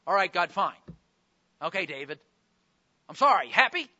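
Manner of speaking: loud, irritated grudging apology; insincere apology. Shown as a demonstration. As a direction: apologise resentfully, just to get it over with. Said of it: "Is that a broken and a contrite heart?".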